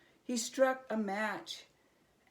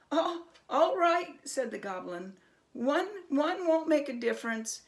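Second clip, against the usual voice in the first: much higher, louder, fast stammering goblin; grudging goblin; 8 semitones higher, 7 dB louder than usual. A narrator's reading. Frightened goblin to Herschel, high and stammering. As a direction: give in nervously.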